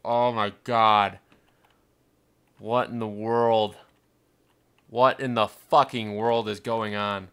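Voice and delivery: loud, slow, drawn-out disbelief; slow, emphatic exclamation; stunned disbelief. Real, not acted. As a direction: stretch each word out loudly in dismay. Gamer stunned by worsening numbers.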